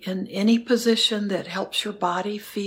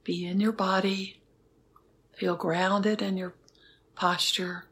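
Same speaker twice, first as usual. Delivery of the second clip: guided meditation. Acted. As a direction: guide them gently into stillness. Leads a metta meditation.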